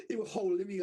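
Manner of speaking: ordinary talk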